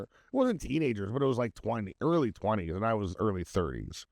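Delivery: ordinary talk, nothing marked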